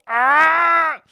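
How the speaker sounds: loud, high mock scream; aunts' battle cry. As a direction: ham up a charging yell. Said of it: "they just go".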